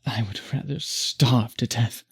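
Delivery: ordinary talk, nothing marked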